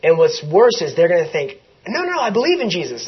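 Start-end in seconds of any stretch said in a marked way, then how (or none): 1.9-3.1 high, quick mock protest; defensive protester. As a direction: rush to insist, imitating a misguided believer. Voicing people who protest defensively.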